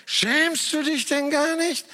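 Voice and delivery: put-on a teacher scolding a clumsy little girl. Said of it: "die Lehrerin sagte".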